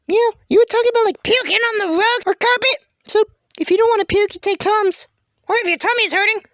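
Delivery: high-pitched eager contestant voice; high cartoon character. Contestant claiming the role of Tums, high character voice. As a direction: pipe up in a squeaky-high character voice.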